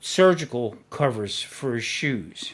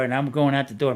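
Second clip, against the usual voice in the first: usual speaking voice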